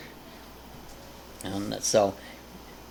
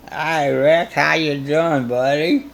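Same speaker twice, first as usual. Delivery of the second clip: mock drunk call, louder and higher; drunk dialing; 4 semitones higher, 6 dB louder, slower than usual. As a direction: slur a cheery drunk greeting. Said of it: "Do a drunk call".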